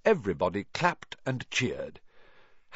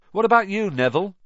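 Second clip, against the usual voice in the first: put-on friendly boy; 10 semitones higher, 7 dB louder, faster than usual. A narrator's reading. Ron asks Neville.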